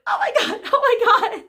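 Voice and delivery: very high squealing oh my God. Retold or imitated. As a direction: squeal like a giddy fan. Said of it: "then I started to squeal".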